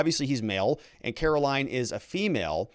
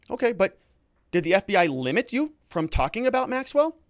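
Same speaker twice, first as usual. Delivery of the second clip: higher, insistent re-enacted question; lightly acted cross-examiner, pitched up; pressing lawyer; 6 semitones higher than usual. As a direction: push the witness for an answer. Acting lawyer pressing the witness.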